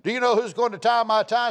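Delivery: a hammed-up husband laying down the law; mock growl. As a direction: bluster like a henpecked man finally playing the boss. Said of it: "shook his fist in his wife's face, face and growled".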